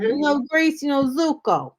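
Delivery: high-pitched imitation with a Jersey accent; Danny Zuko. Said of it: "talking like he's from Jersey".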